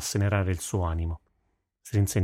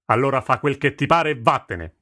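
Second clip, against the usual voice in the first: raised-voice yell from an angry father; 5 semitones higher, 4 dB louder than usual. A narrator's reading. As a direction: throw your daughter out, raising your voice. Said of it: "urlò Livon". (urlo contenuto)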